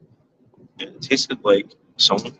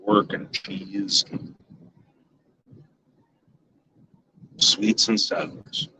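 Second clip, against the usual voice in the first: slow whisper; 5 dB quieter, much slower than usual. Real, not acted. Savoring food memory.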